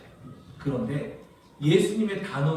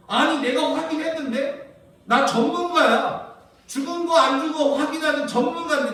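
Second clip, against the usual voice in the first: higher, louder scoffing mourner; mocking; 10 semitones higher, 5 dB louder than usual. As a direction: sneer like a self-proud expert. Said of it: "비웃어요". (비웃듯 높고 크게)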